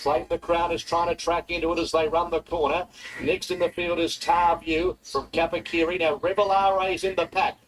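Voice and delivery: rising race call, building excitement; race caller. Real, not acted. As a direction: call the finish as it heats up.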